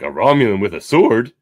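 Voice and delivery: loud, thrilled discovery; delighted Shaxx. Retold or imitated. Shaxx meeting a sword Romulan, loud delight. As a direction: sound like a kid at Christmas.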